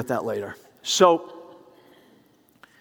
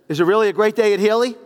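loud, higher-pitched phone prodding; insistent repeated question; 7 semitones higher, 8 dB louder than usual. Retold or imitated. Caller pressing silent receptionist for an answer, loud and insistent. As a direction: push the question louder, pitch up.

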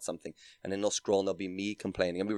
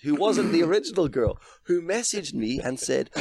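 higher, louder, slowing down; exasperated; 9 semitones higher, 6 dB louder than usual. Real, not acted. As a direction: let the exasperation rise. Exasperated retelling, building.

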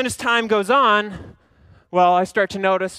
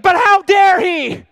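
much higher, louder, slow, hammed-up indignation; over-the-top offended boss; mock outrage; 14 semitones higher, 9 dB louder, slower than usual. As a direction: squeal in theatrical offense. Pastor acts offended at Dan.

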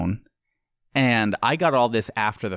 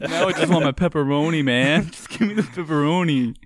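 mock whiny demand; 6 semitones higher than usual. Playing a greedy customer for laughs, mock whiny demand. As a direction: beg for pepperoni like a spoiled kid. Joking pizza-greed bit.

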